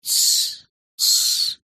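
whispered shushing; hushing quietly. A narrator's reading. Valjean whispers to quiet Marius. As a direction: hush him under your breath. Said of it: "тихим голосом".

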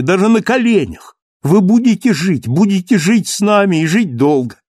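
very high, loud, fervent pleading; impassioned crying out. A narrator's reading. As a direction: beg him to live, voice at full pitch. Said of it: "вскричал Мариус".

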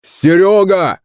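high calling shout. Acted. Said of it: "закричал батя".

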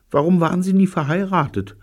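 put-on high, quick girlish question; curious Betty. A narrator's reading. Betty asking the old man about marriage, high and curious.

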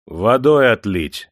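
громкий медленный приказ; отдай приказ громко и протяжно; команда. A narrator's reading. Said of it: "скомандовал Демидов". (громкая команда хозяина)

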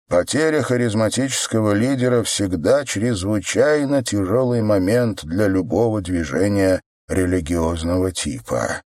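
usual speaking voice

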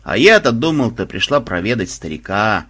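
ordinary delivery, nothing marked

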